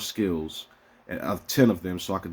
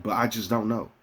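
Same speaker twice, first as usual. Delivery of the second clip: normal talking voice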